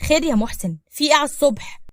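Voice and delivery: loud, annoyed morning challenge; irritated yelling. Acted. Neighbour called out early in the morning, yelling in annoyance. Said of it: "خرج وهو بيزعق".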